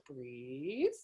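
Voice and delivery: low, drawn-out word gliding up at the end; calming instruction. Retold or imitated. Therapist telling her panicked clients to breathe, mock soothing. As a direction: stretch it out to settle a panicking client.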